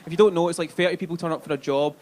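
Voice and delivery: usual speaking voice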